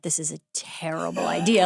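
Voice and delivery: whispered doubt; inner thought. Retold or imitated. Remembering a private doubt about the remake, whispered. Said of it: "I thought".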